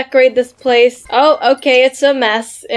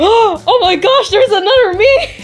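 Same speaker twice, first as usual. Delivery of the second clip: high surprised gasp; 9 semitones higher than usual. Acted. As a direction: gasp then marvel. Doll sees its double.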